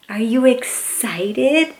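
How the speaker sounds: plain reading voice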